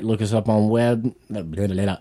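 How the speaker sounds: ordinary talk, nothing marked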